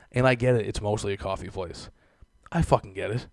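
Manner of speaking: normal talking voice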